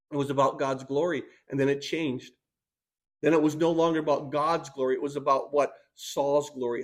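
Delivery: ordinary delivery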